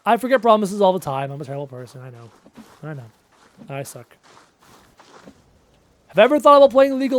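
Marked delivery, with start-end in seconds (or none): 1.3-4.2 mock mopey mumble. Real, not acted. Mock self-pity.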